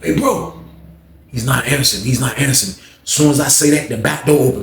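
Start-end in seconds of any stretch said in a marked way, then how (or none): 0.0-0.5 calling out. Retold or imitated. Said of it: "I'm like".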